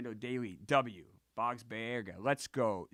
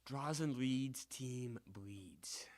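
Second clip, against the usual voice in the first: slow, soft, dramatic repeat; 9 dB quieter, much slower than usual. Acted. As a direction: draw each word out ominously. Repeats rhyme slowly, dramatic.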